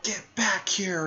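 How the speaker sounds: high, slow retold shout; mock Tsunade yell. Tsunade chasing Orochimaru, a high mock yell. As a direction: call after the fleeing villain. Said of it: "and she's like".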